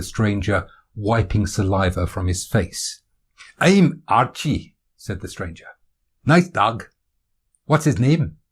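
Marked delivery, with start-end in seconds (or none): 3.6-4.8 slow, high Scottish self-introduction; hearty, unhurried Scottish voice; Scottish accent. Acted. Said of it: "Is that a Scottish accent". 6.2-8.4 friendly, louder Scottish newcomer; higher-pitched, sociable Scottish voice; Scottish accent. Acted. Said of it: "Is that a Scottish accent".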